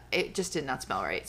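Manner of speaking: usual speaking voice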